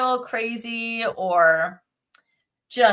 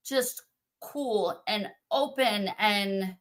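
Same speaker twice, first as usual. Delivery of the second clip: ordinary delivery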